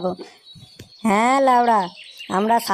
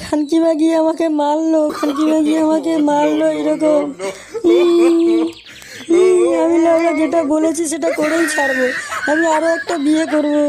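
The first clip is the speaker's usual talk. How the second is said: higher, dragged-out comic wail; mock wailing; 4 semitones higher than usual. Husband wailing after his wife hit him, hammed-up crying. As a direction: whine it like a beaten husband. Beaten husband wails and cries.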